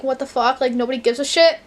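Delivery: ordinary talk, nothing marked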